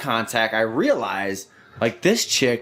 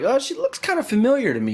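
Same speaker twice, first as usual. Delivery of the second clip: higher musing tone; puzzled; 11 semitones higher than usual. Retold or imitated. Recalling seeing the dancer, higher puzzled musing. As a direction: wonder aloud, higher. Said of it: "I was like".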